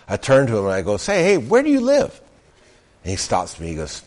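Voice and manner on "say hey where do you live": mock cheerful question. Retelling his own question.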